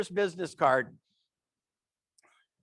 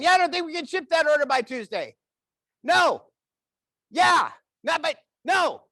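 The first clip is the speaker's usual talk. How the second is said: raised, high voice into a cell phone; loud phone talker; 6 semitones higher, 5 dB louder than usual. Retold or imitated. Man on his cell phone at the airport, talking really loud. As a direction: bellow it like you're oblivious on a call. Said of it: "people talk really loud".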